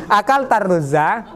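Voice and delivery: "akal tarruza" rising at the end as a question; questioning rise on "tarruza"; question intonation. Shown as a demonstration. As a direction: ask "akal tarruza" with a lift on the last syllable. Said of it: "berarti pertanyaan apa pernyataan?".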